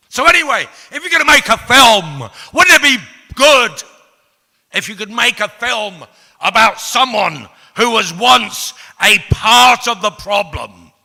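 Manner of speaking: high, full-volume declaiming; impassioned shouted pitch. Acted. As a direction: bellow it like a rousing speech. Shouted pitch for his own film.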